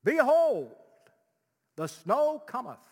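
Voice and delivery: put-on high, loud, pompous old-English voice; hammed-up herald; mock archaic proclamation. Shown as a demonstration. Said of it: "we don't talk like that".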